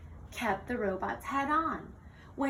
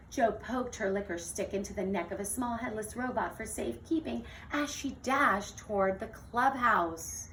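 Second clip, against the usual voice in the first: plain reading voice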